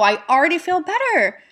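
cheerful and rising in pitch; upbeat affirmation. Shown as a demonstration. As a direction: say it like you truly feel lifted. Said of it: "What can I focus on to feel good".

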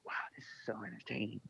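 mock the same-as-always line reading; Morgan Freeman drone. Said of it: "says stuff the same as he always does".

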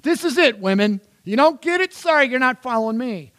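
high, loud mock scolding; harsh false Jesus. Preacher parodies Jesus rejecting women, loud mock scolding. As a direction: lay down an ultimatum in a hammed-up shout. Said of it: "that other voice, that is not his voice".

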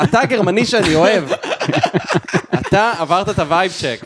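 laughing through the words; delighted laugh while speaking. Retold or imitated. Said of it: "והייתי כזה".

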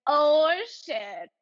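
playfully retold happy surprise; happily flustered. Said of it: "she's so happy with it".

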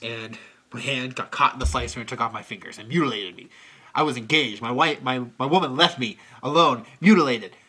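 low, brooding, melodramatic lament; Nicolas Cage's character. Retold or imitated. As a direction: wallow in it like a tragic hero. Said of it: "He's like".

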